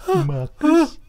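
high, far-off call of a name; high mock call. Podcasters mimic the film's eerie call. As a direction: cry out the name like a ghost.

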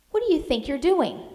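mildly voiced outraged girl; indignant demand. A narrator's reading. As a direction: demand an answer from the rough sailor. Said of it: "Maggie cried indignantly".

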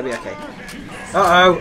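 usual speaking voice

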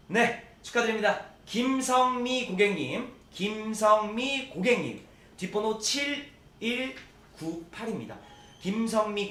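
loud, slow, stretched winner names; drawn-out winner call. Acted. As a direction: call the winner out slowly to everyone.

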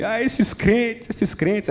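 lamento arrastado e baixinho, de brincadeira; diabo choroso. Acted. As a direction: resmungar choramingando, devagar e sem força. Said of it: "na beira da calçada chorando". (diabo choramingão de piada, lamentando baixinho e arrastado)

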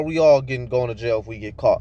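normal talking voice

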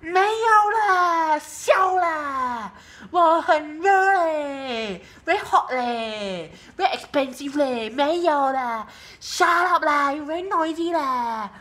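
high, very drawn-out Singaporean Chinese whine; loud, hammed-up Singaporean Chinese accent, stretching each ending. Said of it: "that is the Chinese one".

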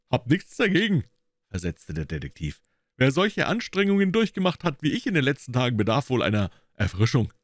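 preen about your weary genius; pompous detective. A narrator's reading. Gregson accepting grog and praising himself to Holmes.

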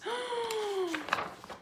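high acted gasp; mock-scared intake of breath; frightened gasp. A narrator's reading. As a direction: suck in air in fright.

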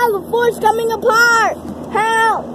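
loud, high playful cry for help; mock panic shout. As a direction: yell like the fort is falling. Fort collapsing, calling for help.

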